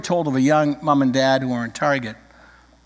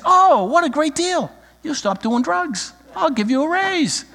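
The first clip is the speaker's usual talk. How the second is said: mock delighted God; 11 semitones higher than usual. Heavenly father accepts the drug deal, hammed-up delight. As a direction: play God thrilled by a silly bargain.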